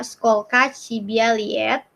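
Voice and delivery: skolka Cibialiet drawn out syllable by syllable; slow Russian demo. Shown as a demonstration. Teacher models how old are you in Russian, slowly. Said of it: "berapa umur kamu atau skolka Cibialiet?".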